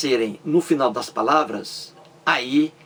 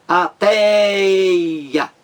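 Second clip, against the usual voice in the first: "Ateia" higher and louder, TEI held very long; teacher's exaggerated long TEI in "Ateia"; stress on TEI; 6 semitones higher, 6 dB louder than usual. Shown as a demonstration. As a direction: stretch out TEI in "Ateia" loudly. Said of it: "É a mais forte".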